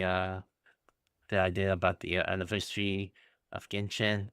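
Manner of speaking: ordinary talk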